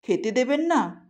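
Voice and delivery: a touch of tearfulness; mildly tearful voice. A narrator's reading. Roshni pleads to keep eating pickle, mildly tearful voice. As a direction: plead softly, slightly close to tears. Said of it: "কাঁদো কাঁদো গলায় বলল".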